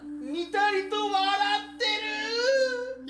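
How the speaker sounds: frightened. Acted. Man describes the grinning ghost.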